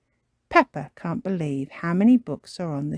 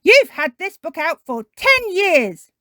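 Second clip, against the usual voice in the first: high, loud and scandalised; scolding shout; 11 semitones higher, 9 dB louder than usual. Acted. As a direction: shout it as an outraged librarian. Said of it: "she shouts".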